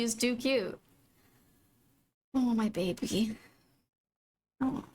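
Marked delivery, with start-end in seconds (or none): 2.3-4.8 tender cooing. Real, not acted. Cooing at cat.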